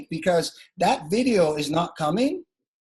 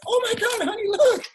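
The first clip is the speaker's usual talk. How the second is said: much higher, squealing like an overexcited spouse; mock giddy excitement. Mocking people who gush to their partner, squealing excitement. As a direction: ham up a giddy gasp to your honey. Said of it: "Here's what most people will do".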